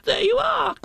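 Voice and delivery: very high, loud squeak; little animal's squealed vindication; triumphant Piglet. Acted. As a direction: crow it in a tiny voice. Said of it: "said Piglet".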